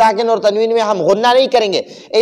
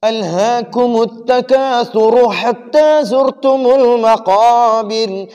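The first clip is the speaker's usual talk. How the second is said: brisk, flowing Quran recitation at hadr pace; 2 semitones higher, much slower than usual. Shown as a demonstration. Teacher recites the whole surah in fast hadr style. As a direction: recite quickly and smoothly. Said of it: "हदर पढ़ते हैं।".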